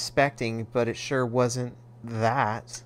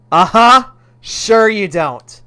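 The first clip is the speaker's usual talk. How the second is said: louder, slow mocking disbelief; sarcastic mom; 7 semitones higher, 11 dB louder, slower than usual. Mother doubting her son, loud and sarcastic. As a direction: drag it out, not buying it.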